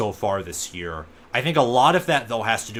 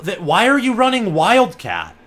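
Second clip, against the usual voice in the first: loud, high disbelieving question; baffled by the call; incredulous; 10 semitones higher, 10 dB louder than usual. Real, not acted. Surprised by the play call.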